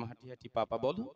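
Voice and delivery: put-on soft coaxing sing-song, as if teaching a baby to walk; coaxing like a parent to a toddler. Son coaxing his old father to walk, playful toddler-talk coaxing. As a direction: gently coax him like a little child. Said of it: "আমার এই শিশু ভাব দেখে বাবা হাসতো".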